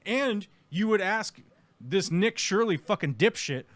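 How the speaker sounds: normal talking voice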